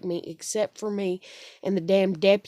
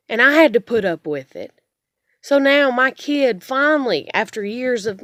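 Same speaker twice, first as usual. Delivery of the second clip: loud, higher, worked-up voice; angry rant; 4 semitones higher, 8 dB louder than usual. Real, not acted. Mother venting about her parents, loud and angry. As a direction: push it out loud and fed up.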